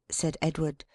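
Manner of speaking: ordinary delivery, nothing marked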